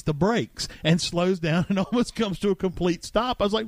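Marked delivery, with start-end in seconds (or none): none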